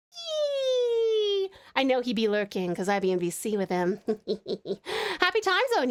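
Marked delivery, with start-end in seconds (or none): 0.1-1.5 long, high "yee" squeal; celebratory squeal. Real, not acted. Streamer celebrating a birthday hat, celebratory squeal. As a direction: let out a sustained high squeal.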